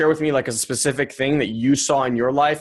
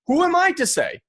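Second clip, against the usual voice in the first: exclaiming. Real, not acted. Rhetorical outburst.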